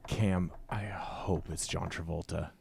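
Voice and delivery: slow, soft whisper. Real, not acted. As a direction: whisper it to him. Aside to teammate during answer.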